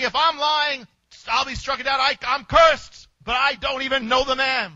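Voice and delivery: loud, high oath; Peter's desperate oath; cursing oath. Retold or imitated. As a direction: swear it with force, slowing at the curse. Peter curses himself in denial.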